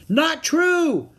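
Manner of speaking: high, forceful contradiction; loud protest. A narrator's reading. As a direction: shout down the false reassurance. Annie contradicts the men loudly.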